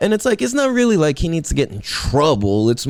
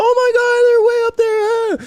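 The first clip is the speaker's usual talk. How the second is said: mock panic, pitched way up; panicky gasp; 4 dB louder than usual. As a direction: squeal it like someone scared of heights. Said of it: "you just go".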